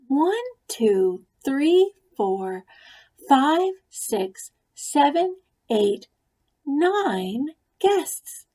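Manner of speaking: high, rising counting, baffled. A narrator's reading. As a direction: count again in disbelief. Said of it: "said Ruby".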